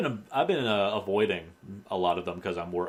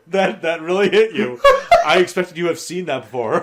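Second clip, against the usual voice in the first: loud, higher voice bubbling with laughter; laughing hard; 9 semitones higher, 13 dB louder than usual. Real, not acted. Teasing the co-host who cracked up, laughing hard. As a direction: talk through your own laughter.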